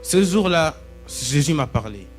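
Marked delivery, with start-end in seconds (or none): none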